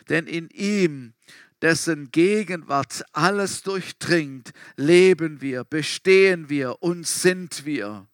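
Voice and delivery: slow, swelling recital of the verse; solemn scripture reading. Acted. Preacher reads Acts verse to congregation, rising in volume. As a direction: read it out with growing weight. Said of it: "sagt es Lukas so".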